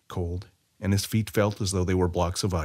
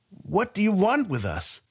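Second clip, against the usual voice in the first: much higher, nervous quavering barkeep; a wary bartender afraid of the stranger; unsteady voice; 11 semitones higher than usual. A narrator's reading. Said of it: "asked in an unsteady voice".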